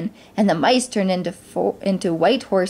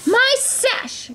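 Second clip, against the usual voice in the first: high-pitched stepsister shriek; outraged cry. A narrator's reading. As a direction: cry out at the theft. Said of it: "cried Drusilla".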